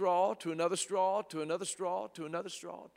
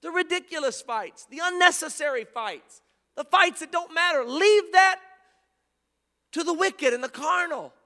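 rising, shouted preaching; fiery pulpit climax; preaching at full force; 10 semitones higher, 6 dB louder than usual. Acted. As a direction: build the list up to a roar.

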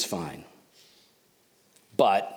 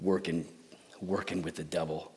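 quiet echo; 9 semitones lower, 10 dB quieter than usual. Real, not acted. Echoing the accusation quietly.

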